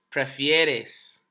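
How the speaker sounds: prefieres said slowly with the ie spelled out, pre-FIE-res; slow model word. Shown as a demonstration. Teacher to class: models prefieres slowly and clearly. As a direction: draw out the ie in prefieres. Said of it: "instead of".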